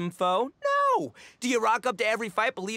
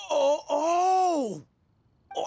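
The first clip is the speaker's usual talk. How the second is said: excited realization; 6 semitones higher, 4 dB louder, much slower than usual. Acted.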